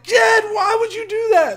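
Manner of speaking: high, loud, slow panicked shout; alarmed shout. Retold or imitated. Shouting at friend Jed after the insult, high loud alarm. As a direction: yell at your friend in disbelief. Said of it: "I'm like".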